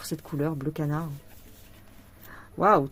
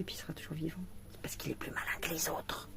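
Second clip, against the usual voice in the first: whisper; 4 semitones lower, 9 dB quieter than usual. Real, not acted. Whispers about the surviving butterfly.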